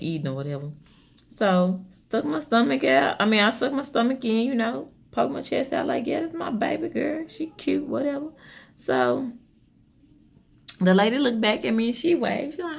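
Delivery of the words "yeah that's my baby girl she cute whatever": proud mom voice. Retold or imitated. Mother posing proudly at pool.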